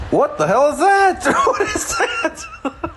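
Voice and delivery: high squealing laugh, slow; laughing disbelief. Real, not acted. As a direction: crack up at the screen. Laughing at dancing beaver.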